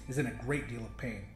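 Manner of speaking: ordinary delivery, nothing marked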